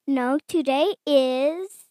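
ordinary delivery

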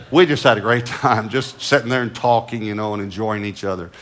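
usual speaking voice

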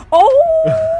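very high, loud startled oh; a grandmother's loud high gasp; grandma's shocked oh. Retold or imitated.

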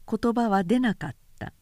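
ordinary delivery

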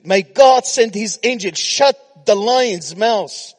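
high, very loud scripture proclamation; preaching. Acted. Preacher promising God's company in the lion den, full-force preaching. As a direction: declare it at full force to the whole room.

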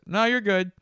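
high, loud breezy voice; easygoing reply. Retold or imitated. Truck guy reassuring them, high and loud. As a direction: toss it off lightly. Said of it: "And he goes".

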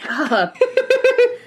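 high-pitched burst of laughter; real giggling outburst; helpless laugh. Real, not acted. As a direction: lose it again briefly. Still laughing after apologising.

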